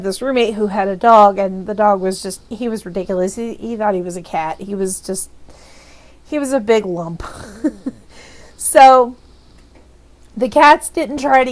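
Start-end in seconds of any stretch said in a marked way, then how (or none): none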